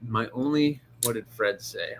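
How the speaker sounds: ordinary talk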